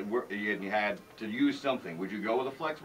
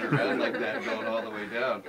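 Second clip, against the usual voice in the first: laughing. Real, not acted. Host laughs at sled image.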